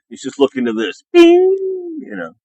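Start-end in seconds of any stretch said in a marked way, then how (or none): 1.1-2.0 high ringing "bing" noise; imitated bing. Streamer sounding out a little noise for chat, high imitated bing. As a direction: voice a high, sustained ping.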